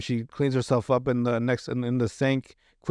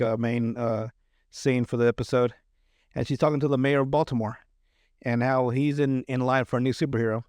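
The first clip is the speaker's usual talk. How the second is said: ordinary talk, nothing marked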